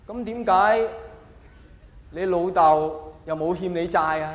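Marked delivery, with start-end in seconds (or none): none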